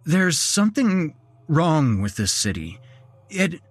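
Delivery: high, halting alarmed plea; trying to keep from stammering; a scared man warning the group; stumbling, urgent. Acted. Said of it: "I stumbled over them".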